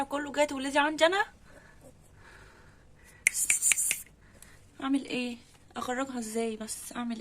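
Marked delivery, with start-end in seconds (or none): 3.3-3.9 pss pss calling. Real, not acted. Calling the cat.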